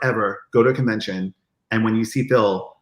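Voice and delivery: ordinary talk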